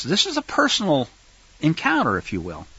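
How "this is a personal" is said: ordinary talk, nothing marked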